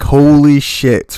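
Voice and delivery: retold shock. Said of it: "I was like".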